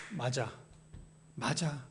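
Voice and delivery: very low, hushed acted concession; resigned agreement. Doubting believer to self, quiet resigned agreement. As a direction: give in quietly, dropping the pitch right down.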